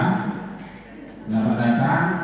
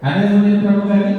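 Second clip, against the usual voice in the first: loud, fast, higher call to the class; teacher calling out loudly for volunteers; 7 semitones higher, 6 dB louder, much faster than usual. Real, not acted. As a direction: throw the question to the back row.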